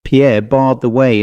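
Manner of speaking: plain reading voice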